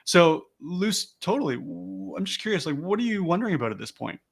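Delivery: soft, gently interested question; genuinely curious. Shown as a demonstration. Sales coach gently drawing out a lead's concerns, genuinely curious. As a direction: ease off and ask with open curiosity. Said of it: "Notice the difference in tone".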